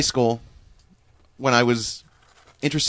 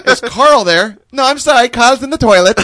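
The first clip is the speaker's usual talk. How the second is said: high, loud mock German mom; imitating mom; 8 semitones higher, 7 dB louder than usual. Son retells mom answering the phone, high and loud. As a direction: announce it like a mom calling through the house.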